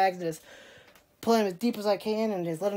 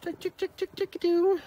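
chicken call; 9 semitones higher, slower than usual. Retold or imitated. Calling the chickens.

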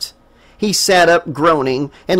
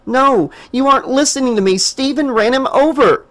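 high, fast urgent insisting; frantic insisting; 9 semitones higher than usual. A narrator's reading. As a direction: push him to listen, urgently. Jessica frustrated.

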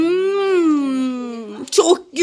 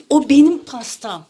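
normal talking voice

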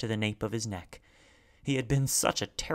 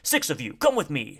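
loud, projected command; shouted; 4 semitones higher, 6 dB louder than usual. A narrator's reading. Man orders a search party together, shouted. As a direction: bark the order. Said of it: "shouted a man".

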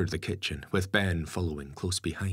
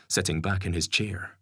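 usual speaking voice

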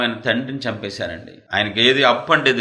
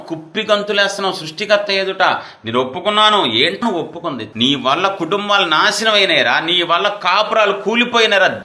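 fiery preaching; 7 semitones higher than usual. Acted. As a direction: preach it out at full force. Preaching at full force.

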